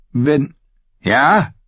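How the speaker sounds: high, rising challenge; irritated snap. Acted. Stannis challenges Sam's hesitation, irritated and sharp. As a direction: throw it back sharply as a question. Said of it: "fauchte Stannis".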